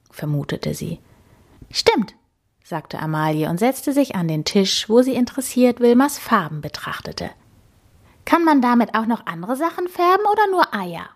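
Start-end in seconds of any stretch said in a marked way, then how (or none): 1.7-2.1 much higher, quick agreement; a high, bright yes; lively piglet girl; eager wild boar girl. A narrator's reading. 8.2-11.1 much higher and fast; nosy young boar, high; curious wild boar girl. A narrator's reading.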